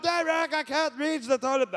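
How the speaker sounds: high-pitched mock girlfriend call; imitating a whiny girlfriend calling. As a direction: call for help through the door. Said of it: "So she's called".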